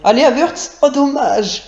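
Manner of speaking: high excited cheer then groan; excited shout. Real, not acted. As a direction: cheer him on, then sag. Urging a header, then a miss.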